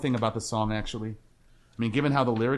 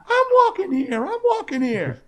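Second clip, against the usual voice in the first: far higher, louder cartoonish yell; mock street yell; 7 dB louder than usual. Martin McFly Junior yelling at a car, shrill loud mock yell. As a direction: squawk it like an angry pedestrian. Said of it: "Martin McFly Junior".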